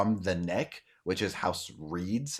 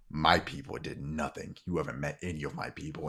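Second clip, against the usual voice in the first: restrained anger; 5 semitones lower, 5 dB quieter than usual. Acted. Man rebuts while grabbing accuser.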